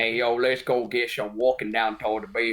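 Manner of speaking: mock New York walk with extra Western; botched New York accent, too much Western. Imitating Steve's try at New York, overdone Western accent. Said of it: "but with, like, like, a extra Western".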